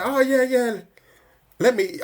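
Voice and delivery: high, bright mock eager voice; mock eager viewer. High mock eager student answering the art teacher. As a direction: ham up the overeager student.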